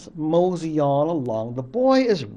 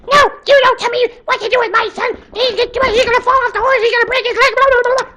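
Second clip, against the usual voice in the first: much higher, louder and fast, a nagging mother voice; scolding mother; 8 dB louder, much faster than usual. Acted. Mother telling father the boy will break his leg, high fast nagging. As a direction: rattle off her warnings in a high rush.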